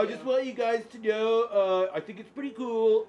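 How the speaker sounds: mock YouTuber voice. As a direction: parody an overeager reaction vlogger. Parodying a reaction video host.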